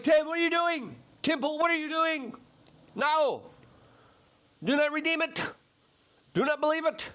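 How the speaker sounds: mock pleading yell. Podcaster pleading with fellow podcaster Tim, high frantic plea. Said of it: "I'm like".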